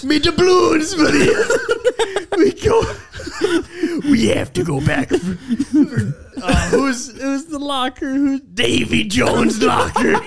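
loud, high pirate shout with laughter; pirate yelling about doubloons and Davy Jones. Acted. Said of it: "but a pirate".

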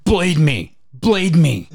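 mock frustrated demand. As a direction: imitate an impatient customer demanding it. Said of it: "got frustrated that Matt wasn't using the knife".

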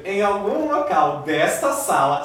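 ordinary delivery

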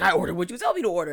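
very fast defensive reply; quick flustered excuse; rushed defense. Retold or imitated. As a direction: rattle it off defensively. Partner's defensive reply retold.

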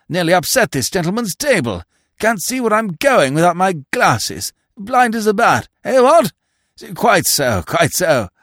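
put-on high, fluttery elderly toff; absent-minded old earl. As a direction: dither like a vague old lord. Earl rambles.

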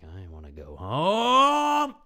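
whiny crying. Retold or imitated. Kid at a sleepover begging to go home, wailing. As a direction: draw it out like a kid about to bawl.

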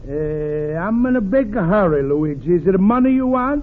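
put-on sly, booming Italian accent of a scheming friend; Italian accent, the crafty pal dropping by. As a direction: Pasquale greets Luigi in a thick Italian accent. Pasquale, Italian accent throughout.

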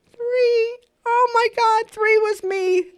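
high, loud reenacted shriek of panic; shocked outburst. Student recalls being called first by the teacher, shocked outburst. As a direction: blurt it out high and loud, horrified.

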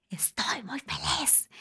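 breathy excited whisper, slow. Real, not acted. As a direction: whisper it like a happy secret. Whispers happiness to listener.

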